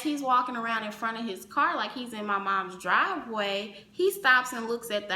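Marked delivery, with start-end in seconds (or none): none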